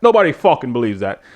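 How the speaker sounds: ordinary talk, nothing marked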